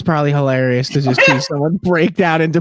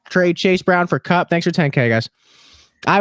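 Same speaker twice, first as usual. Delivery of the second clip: ordinary talk, nothing marked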